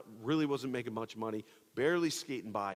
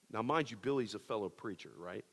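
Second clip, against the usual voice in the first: normal talking voice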